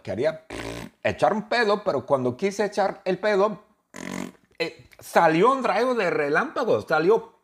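high, loud, giddy narration; squeaky giddy retelling; excited storytelling. Acted. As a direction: build up to the silly punchline. Excited high telling of fart.

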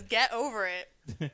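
slow, mildly sassy retort; a little spicy. Retold or imitated. Host quotes the sister's retort to critics, a little spicy. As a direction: imitate a touch of spice, drawn out. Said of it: "Jess is getting a little spicy".

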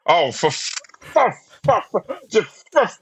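high, strangled frustrated huffs; a crowd half-swearing in frustration; exasperated groan. Retold or imitated. Frustrated passengers groaning at the captain's bad news. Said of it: "people in the background going".

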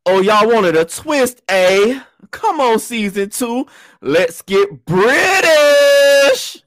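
high, slow, drawn-out hype call; hyped-up intro. Acted. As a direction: stretch the last word into a loud high holler. Hyped-up season opening shout.

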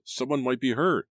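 high, worried protest. A narrator's reading. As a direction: press the worry, pitched well up. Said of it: "Carlo protested".

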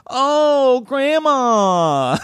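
high, mocking coo of oh grandma; mock heckler voice. Comic imitating heckler cooing about his grandma.

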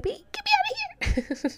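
put-on high, laughing baby voice. Voicing baby Evelyn's wish to leave, high and laughing. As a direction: squeak it as the baby would.

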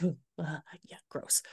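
whispered, low ugh of disgust; breathy whispered gross; disgusted groan. Retold or imitated. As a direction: recoil from a bad smell, under breath. Reacting to trigger cologne.

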